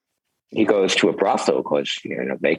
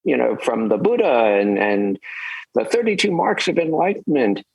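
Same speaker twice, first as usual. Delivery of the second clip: lightly raised, earnest disciple voice; eager disciple; 9 semitones higher than usual. Retold or imitated. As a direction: tell it with eager wonder. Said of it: "He says".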